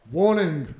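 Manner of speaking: slow, loud, formal warning voice; solemn on-screen warning read; warning announcer. Shown as a demonstration. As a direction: read it like an official disclaimer.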